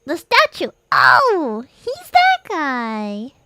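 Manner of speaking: high loud excited exclaim; excited recognition. Real, not acted. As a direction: burst out recognizing him.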